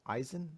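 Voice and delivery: "Aizen" said as EYE-zən, soft and questioning; unsure name guess. Real, not acted. Trying to recall the professor's name, "Aizen" as a soft EYE-zən. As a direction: try "Aizen" with an EYE start, rising.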